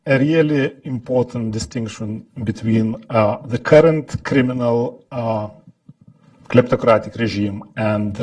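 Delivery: English with a Lithuanian accent. Real, not acted. Lithuanian speaker giving a talk.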